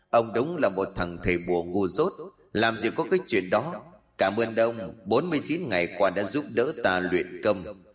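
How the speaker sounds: soft, otherworldly taunting voice; mocking plant spirit. Spirit reveals its betrayal to dying sorcerer, softly taunting.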